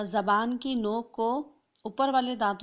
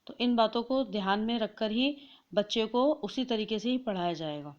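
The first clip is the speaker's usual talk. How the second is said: ordinary delivery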